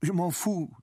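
put-on boy's upset, high-pitched outburst; tearful outburst. A narrator's reading. Boy rebuffs the café owner's praise, tearful outburst. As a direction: blurt it high, on the edge of tears. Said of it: "dit le gamin qui fondit en larmes".

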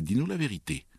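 high, needling insinuation; caustic, treacherous. A narrator's reading. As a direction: goad a confession out with mock sweetness. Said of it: "sur un ton de perfidie caustique".

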